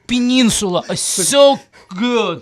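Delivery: "peninsula, is so good" in a heavy Russian accent, slowed down. Shown as a demonstration. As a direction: stretch out "so" with a thick Russian accent. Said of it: "if I emphasize my Russian accent".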